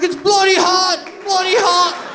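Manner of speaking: high, loud, hammed-up London accent; mock London accent. Children playing in pretend London fog, shouted mock London accent. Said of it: "We would just talk with accents".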